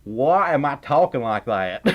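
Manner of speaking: put-on thick Southern accent; puzzled at your own Southern twang; talking Southern. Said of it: "if I keep talking Southern".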